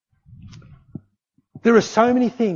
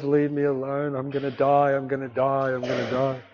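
imitated miserable moan, run together in a low monotone; 3 semitones lower than usual. Sick woman under the covers refusing to get up, moaning. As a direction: moan it out, sinking lower with each repeat. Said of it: "she's just moaning".

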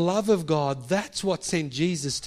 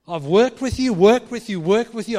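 "worked" punched loud each time; loud mock frustration on "worked"; rising exasperation; 5 semitones higher, 8 dB louder than usual. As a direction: hammer "worked" harder and louder. Repeated exasperated phrase.

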